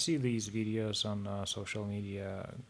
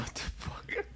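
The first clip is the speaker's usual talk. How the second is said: breathy laughing what the fuck; laughing disbelief; 5 dB quieter than usual. Real, not acted. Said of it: "laughing disbelief".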